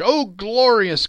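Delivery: slow, high, loud rapture; rapturous exclamation; exultant. A narrator's reading. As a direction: draw it out with wonder. Narrator exults in the bells.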